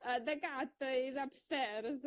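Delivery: imitating her old Italian-accented English, stilted; broken beginner English. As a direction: sound like a learner reciting a textbook line, in an Italian accent. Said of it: "it used to be like".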